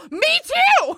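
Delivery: high mock shout, retold; yelled retort. To her date, a high yelled retort.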